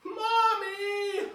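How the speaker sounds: high, loud wail; crying out Mommy. Acted. Little girl wailing for her mommy. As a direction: cry out for mommy. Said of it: "I cried".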